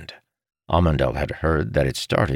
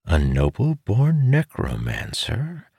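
slow, higher, puzzled question; wondering; 6 semitones higher, slower than usual. A narrator's reading. Amandal puzzling over a noble necromancer, wondering. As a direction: muse on it slowly, lifting the pitch. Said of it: "Amandal wondered".

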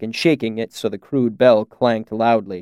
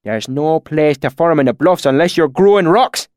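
heated, higher country-dialect voice; hot retort; 4 semitones higher, 4 dB louder than usual. A narrator's reading. Swineherd scoffing at talk of farms, hotly. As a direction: retort like an exasperated bumpkin. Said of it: "he said hotly".

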